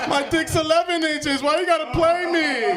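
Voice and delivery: high, loud, hammed-up wail; mock crying. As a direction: whine it through fake tears. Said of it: "I start crying like".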